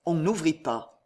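usual speaking voice

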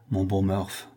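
usual speaking voice

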